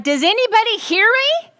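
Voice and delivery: high, loud exasperated plea; exasperated cry. Retold or imitated. Yeshua pleading with his disciples, loud and high. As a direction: cry out as if no one is listening. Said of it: "he's like".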